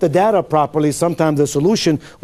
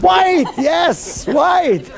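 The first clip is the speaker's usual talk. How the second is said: excited cheer; 10 semitones higher, much slower than usual. Real, not acted. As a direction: celebrate the right answer. Trainer cheers the right answer.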